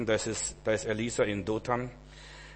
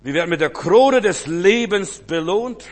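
higher, louder, full-force sermon voice; fervent preaching; 7 semitones higher, 9 dB louder than usual. Acted. Preacher telling David's story, loud fervent preaching. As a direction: preach it out with fire.

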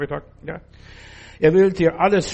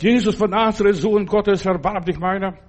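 a blind beggar yelling for help; crying out loud; 3 semitones higher, 5 dB louder than usual. Retold or imitated. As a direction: call out to Jesus across the crowd. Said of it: "Und er rief nochmals lauter".

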